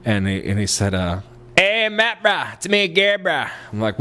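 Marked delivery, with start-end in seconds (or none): 1.6-3.5 high, exaggerated Texan bro voice; hammed-up friendly bro caricature; Gary. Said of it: "someone starts talking like that".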